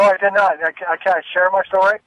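normal talking voice

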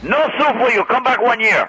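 high, barked sitcom catchphrase impression; Soup Nazi. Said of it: "And soup Nazi goes".

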